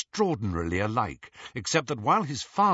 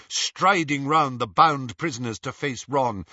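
usual speaking voice

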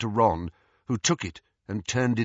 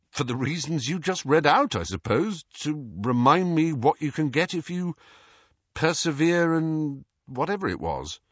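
lightly voiced boy, higher and hesitant; Harry, offhand; 6 semitones higher than usual. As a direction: answer vaguely, trailing into a shrug. Harry answers Scrimgeour.

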